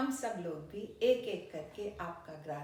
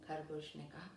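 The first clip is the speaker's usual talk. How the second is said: ordinary delivery, nothing marked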